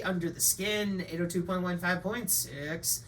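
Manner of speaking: ordinary talk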